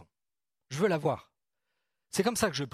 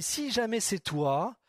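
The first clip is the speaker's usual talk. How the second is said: hesitant request; slower than usual. Retold or imitated. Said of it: "Il a dit".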